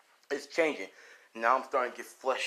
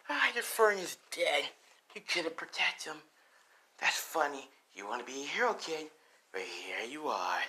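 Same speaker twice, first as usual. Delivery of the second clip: taunting villain; 3 semitones higher than usual. Acted. Laughing villain taunting the hero.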